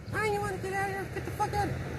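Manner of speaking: high squeaky mock of the wife snapping. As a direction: squeak it out like a shrill caricature of his wife. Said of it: "So she was like".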